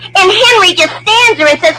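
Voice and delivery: usual speaking voice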